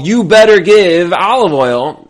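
drawn-out imitation of someone screaming orders; mock screamed demand. Moshe screaming at people for oil, mock screamed demand. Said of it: "You start screaming at people".

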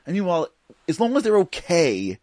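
usual speaking voice

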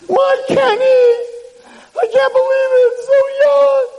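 high, loud grief-stricken wail; hammed-up shrieking mother; mother screaming. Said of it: "starts screaming".

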